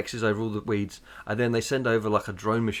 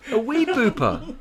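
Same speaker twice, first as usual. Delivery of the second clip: high laughing delight at the idea; 10 semitones higher, slower than usual. Real, not acted. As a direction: giggle it out, thrilled.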